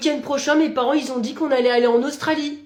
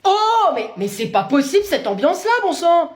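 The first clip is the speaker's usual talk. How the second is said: exasperated mother; 6 semitones higher, 4 dB louder than usual. Acted.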